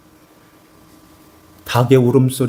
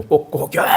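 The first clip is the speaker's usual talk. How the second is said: rooster crow; 4 dB quieter than usual. Retold or imitated. Rooster crowing in story.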